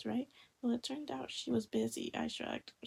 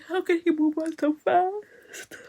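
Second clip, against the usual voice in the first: high, louder mock wail; heartbroken heroine; 6 semitones higher, 9 dB louder, slower than usual. Retelling the heroine's cry after seeing her ex. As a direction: ham up the jilted girl's cry. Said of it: "she's like".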